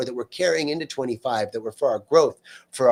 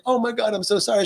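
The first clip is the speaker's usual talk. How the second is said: much higher, startled oh my God; shocked sympathy; 9 semitones higher, faster than usual. Retold or imitated. Comforting a crying friend at ceremony, shocked sympathy. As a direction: gasp it out in sudden sympathy. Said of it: "And I was like".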